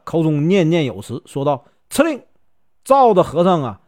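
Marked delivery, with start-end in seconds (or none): 1.9-2.2 high, sharp command to the spirits; spell incantation. Acted. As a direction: snap out the spell word high. Taoist casting a spell.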